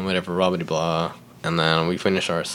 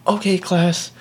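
high-pitched imitated teacher; higher mock-teacher announcement; teacher addressing class; 9 semitones higher, slower than usual. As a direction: summon the class's attention. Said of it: "And she's like".

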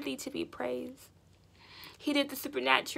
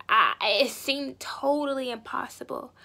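usual speaking voice

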